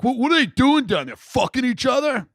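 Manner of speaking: high, loud taunting shout; mocking older brother. Older brother taunting the kids in the basement, high loud jeer. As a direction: holler it like a jeering teen. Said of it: "He's like".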